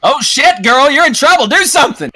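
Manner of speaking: high, mock-panicked urging; urgent prodding. Game master to tongue-tied player in trouble, high urgent prodding.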